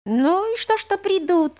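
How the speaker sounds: put-on teasing old man, pitched high. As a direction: egg her on playfully. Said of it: "как бы подзадоривал её юродивый".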